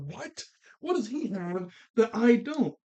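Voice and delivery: high-pitched, put-on incredulous protest; mock indignant disbelief. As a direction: imitate a rejected rival squeaking in disbelief. Jealous rival's reaction acted out.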